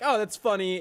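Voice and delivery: amused high voice. Retold or imitated. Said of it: "you're like".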